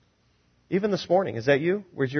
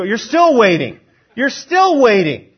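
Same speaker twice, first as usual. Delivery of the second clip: raised, emphatic exasperation; exasperated waiting; 6 semitones higher, 6 dB louder than usual. Acted. Preacher voicing believers tired of waiting, mildly exasperated. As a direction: lean hard on the wait, louder and higher. Acts out frustrated waiting on prayer.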